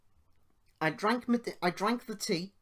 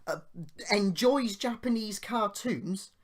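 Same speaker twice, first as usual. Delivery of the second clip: higher and louder, outraged retelling; indignant rant; 6 semitones higher, 5 dB louder than usual. Real, not acted. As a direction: fume about the cheek of it all. Indignant rant about the insult.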